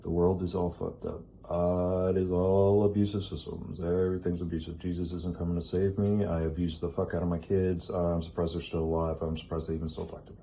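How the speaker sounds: low, soft, defeated mumble; resigned inner voice. Retold or imitated. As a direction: mutter the excuses as if to yourself. Voicing a resigned person's inner excuses.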